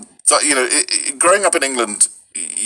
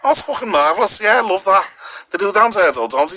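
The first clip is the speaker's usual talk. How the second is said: higher, lilting friendly Scouse; friendly Liverpool accent; 8 semitones higher than usual. Shown as a demonstration. Showing how friendly Liverpool sounds, higher Scouse accent. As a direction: chat like a friendly Liverpudlian, pitched up. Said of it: "but very friendly".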